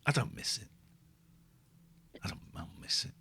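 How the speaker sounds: ordinary talk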